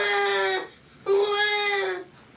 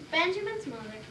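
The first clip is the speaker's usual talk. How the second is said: plain reading voice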